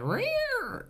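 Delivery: high-pitched strangled cat cry; imitated cat yowl. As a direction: mimic a distressed cat's yowl. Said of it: "I heard, like".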